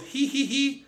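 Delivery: very high, squeaky giggle; high-pitched acted tittering; silly hee-hee giggle. A narrator's reading. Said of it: "he giggled".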